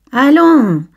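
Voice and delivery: bright teasing call, a laugh in it. Acted. Laurent calls Camille into the boat, laughing. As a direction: call him aboard with a laugh. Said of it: "lui cria Laurent en riant".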